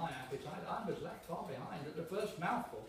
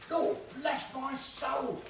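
high, loud, slow hammed-up exclamation; exclaiming; 11 semitones higher, 8 dB louder, much slower than usual. Waiter feigning shock over the chops, hammed-up exclaim. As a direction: throw up your hands in mock shock. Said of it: "he exclaimed".

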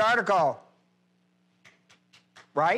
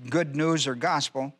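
ordinary delivery

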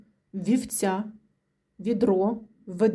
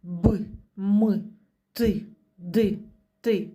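Б, М, Т, Д tapped out short and hard like a little hammer; hammered consonants; slower than usual. Shown as a demonstration. Teacher tapping hard consonants for children, sharp and short. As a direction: knock each sound out like tapping a hammer. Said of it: "як молоточком стучимо".

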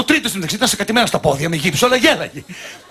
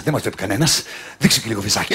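sly coaxing husband; 11 semitones lower, 5 dB quieter than usual. Retold or imitated. Husband coaxing wife at zoo.

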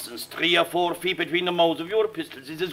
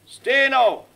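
usual speaking voice

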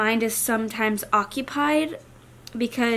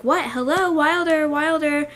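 husband calling on phone; 5 semitones higher, 4 dB louder than usual. Retold or imitated. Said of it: "Garrick said that he was saying".

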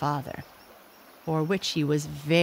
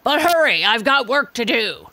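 loud, higher gruff reply; gorilla voice; 6 semitones higher, 8 dB louder than usual. A narrator's reading. Gorilla to the monkeys, loud and gruff. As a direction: brush them off. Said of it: "said the gorilla".

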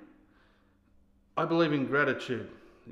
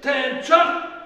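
full parade-ground bellow, pitched very high; shouted drill command; 12 semitones higher, 11 dB louder, slower than usual. Acted. Sergeant cuts off the soldier's doubts, bellowing a command. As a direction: cut in with a sudden military shout.

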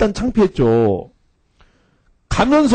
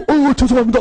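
higher, mock startled apology; retold fluster, voice pitched up; 8 semitones higher than usual. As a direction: stammer out an embarrassed sorry.

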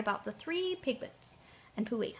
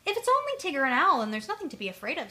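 fast relieved rush; 4 semitones higher, 8 dB louder, faster than usual. Acted. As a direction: blurt it out in relief. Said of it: "greatly relieved".

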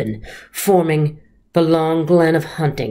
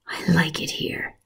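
soft voiceless whisper; 8 dB quieter than usual. Acted. Snake confiding to Patrick, whisper. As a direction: lean in and breathe it like a secret.

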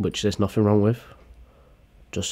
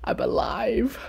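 high, gasping mock relief; gasp, then squeeze out high, slow words fading breathless; imitated gasp; 14 semitones higher, slower than usual. Choker survives the grapes, gasping mock relief. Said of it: "That gasp".

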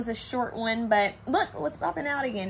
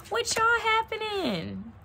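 slow, high Southern country twang; Southern country drawl; 11 semitones higher, slower than usual. Pretend. Tarot reader slips into a slow Southern country drawl. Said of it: "is somebody Southern country over here".

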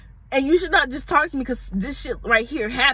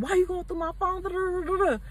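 higher, softer mock-defensive whine; defensive boyfriend; 7 semitones higher, 4 dB quieter than usual. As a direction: play him dodging the question. Boyfriend's defensive reply voiced.

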